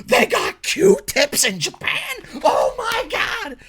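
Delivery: mock gushing blogger. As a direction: ham up a blogger thrilled by nothing. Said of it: "it's like Japanese bloggers".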